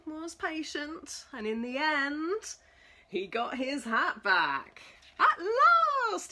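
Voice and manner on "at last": relieved sigh. Acted. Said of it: "he sighed".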